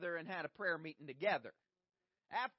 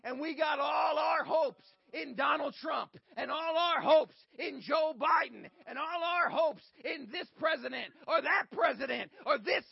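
rising impassioned oratory; 6 semitones higher, 9 dB louder than usual. Acted. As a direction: build the sermon to a shouting peak. Preaching at full force.

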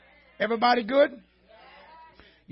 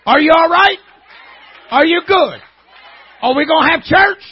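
excited call-out; 6 semitones higher, 14 dB louder than usual. Real, not acted. Preacher checking on the crowd.

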